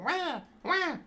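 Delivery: very high mock baby wailing; imitated baby cry. Imitating a crying baby in a story to chat, in a high mock wail. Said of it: "Your little baby's crying".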